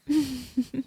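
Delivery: light, short run of chuckles; vampire's little laugh; amused chuckle. Acted. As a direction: giggle briefly to yourself.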